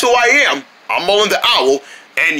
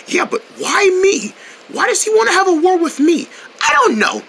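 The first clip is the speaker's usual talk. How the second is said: high-pitched, bewildered whine; distressed protest; 10 semitones higher than usual. Acted. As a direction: protest high, as if unfairly picked on. Protests being picked for war.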